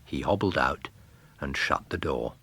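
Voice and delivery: plain reading voice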